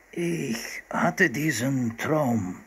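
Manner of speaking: very slow, drawn-out man's voice; seal hunter. A narrator's reading. Hunter telling the children his dream, very slow. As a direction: stretch every word like a deliberate storyteller. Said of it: "erzählte der Mann".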